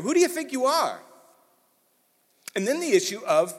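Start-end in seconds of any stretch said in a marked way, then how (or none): 0.0-1.0 indignant challenge. Retold or imitated. Repeating the establishment's challenge.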